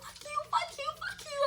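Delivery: imitated tearful cursing, tiny and very high; hammed-up weepy outburst in falsetto; mock crying. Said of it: "I'm on the other end crying".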